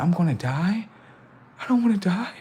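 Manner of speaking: high, worried questioning; re-enacted anxious, high voice; mock alarm. Retells reaction to brother's warning.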